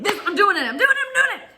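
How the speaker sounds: high, loud frantic chant; panicked chant of determination; frantic excitement. Retold or imitated. Racing to slam the door.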